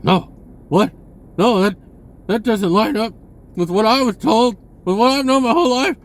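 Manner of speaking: loud, high mock outrage at a heretic; closed-minded person recoiling. Closed-minded people rejecting his suggestions, loud and high in mock outrage. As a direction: shove the suggestion away like it's the devil. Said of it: "They're like".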